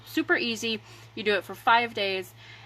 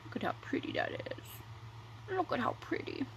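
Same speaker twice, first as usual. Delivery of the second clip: whispered admiration; 4 semitones higher, 11 dB quieter than usual. Real, not acted. Admiring lashes under her breath.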